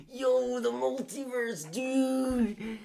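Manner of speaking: high, slow, drawn-out surfer dude voice; stoner dude impression. Retelling how the actor gushed, in a high slow dude voice. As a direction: stretch it out like an awed stoner. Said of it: "he's like".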